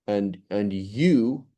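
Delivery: YOU drawn out slowly; long, weighted YOU; stress on YOU. Shown as a demonstration. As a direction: linger emphatically on the word YOU. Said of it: "It should be".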